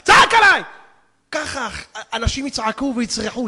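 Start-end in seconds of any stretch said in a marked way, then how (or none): 0.0-0.6 loud, high, rising to a yell; accusing shout. Acted. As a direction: shout the reproach as an outraged accuser. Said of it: "ויצעק עליי". (צעקת האשמה זועמת)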